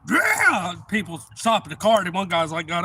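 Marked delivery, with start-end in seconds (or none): 0.1-0.8 high, loud victory yell; celebratory shout. Retold or imitated. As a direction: shout it out during the high-five.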